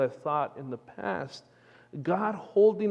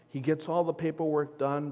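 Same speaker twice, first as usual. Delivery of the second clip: ordinary talk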